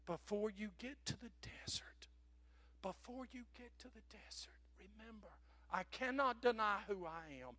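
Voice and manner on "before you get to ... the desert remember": whispered, soft and urgent; whispering the warning; hushed whisper. Real, not acted. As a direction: drop to a whisper for emphasis. Preacher hushes a key phrase.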